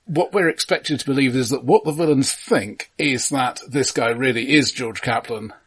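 ordinary delivery

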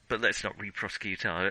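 ordinary delivery

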